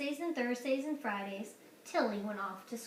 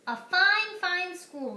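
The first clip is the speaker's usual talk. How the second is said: higher, slowed fine fine; lightly voiced proud headmaster; proud principal; 7 semitones higher, 8 dB louder, slower than usual. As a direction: savour the praise. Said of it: "Mister Keene said".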